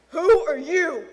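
snapped, very loud demand; angry snap. A narrator's reading. Man confronting an intruder, angry snap. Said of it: "The man snapped".

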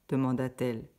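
ordinary delivery

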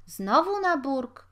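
a slow, high, plaintive whine; whining. A narrator's reading. Tavern keeper whines at Ignacy drinking on credit again. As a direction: complain like you're about to cry. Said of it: "spytał Żyd płaczliwie".

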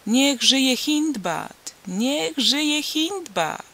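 high, slow drawn-out cheering call; crowd cheer. Retold or imitated. Crowd hailing the new groom, high slow cheer. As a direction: cheer him like a festive crowd. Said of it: "słyszałem, jak wołano".

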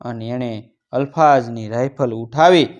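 ordinary delivery

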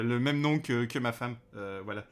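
ordinary talk, nothing marked

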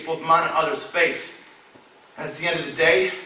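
loud, high, driving slam poetry recital; urgent poetic prayer, pitched well above normal talk; spoken word poem. Acted. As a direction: perform it as an impassioned plea to God.

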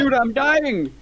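high-pitched panic; panicked gamer. Real, not acted. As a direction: cry out that you're under attack. Attacked by monsters in game.

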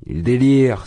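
loud, high exclamation; high-pitched drunken outburst; drunken cry. A narrator's reading. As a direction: burst out tipsily at full voice. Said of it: "s'écria ivre Razumikine".

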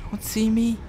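voiceless pleading whisper; frightened whisper. Real, not acted. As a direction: beg it not to notice you. Hiding from monster.

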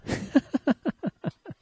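risita muy aguda de burla; ríete con burla, muy agudo; risa burlona. Acted. Compañeros se burlan de su recitación. (carcajada aguda y burlona)

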